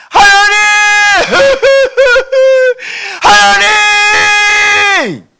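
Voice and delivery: wailing, high, loud shouts to God; hammed-up prayer howl, drawn out; crying out in prayer. Shown as a demonstration. Demonstrating loud prayer to a God right in front, crying out. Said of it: "왜 이렇게 부르짖어도".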